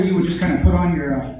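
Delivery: ordinary talk, nothing marked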